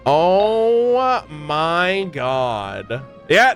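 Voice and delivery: ordinary delivery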